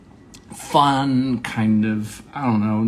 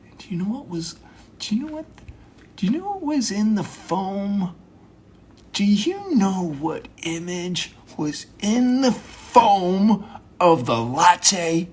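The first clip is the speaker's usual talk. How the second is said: mock big talk; 8 semitones higher than usual. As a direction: repeat the question with mounting mock intensity. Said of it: "have small talk as if it's big talk".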